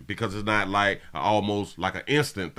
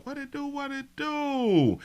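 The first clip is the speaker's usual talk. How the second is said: very high, drawn-out falsetto; falsetto greeting. Real, not acted. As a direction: squeal it up high and slow. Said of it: "falsetto greeting".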